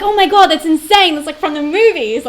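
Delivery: high, loud gushing tourist; overwhelmed tourist gushing at a fire hydrant; starstruck gushing. Retold or imitated. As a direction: squeal like a wide-eyed country bumpkin. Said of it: "I sound like such, like, a country bumpkin".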